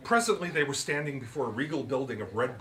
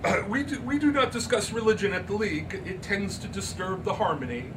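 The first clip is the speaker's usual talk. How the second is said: much higher, louder, grand and proud; haughty society lady; 8 semitones higher, 4 dB louder than usual. Acted. Miss Turpin boasting to Andrew, haughty and high. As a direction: play the painted secretary with relish.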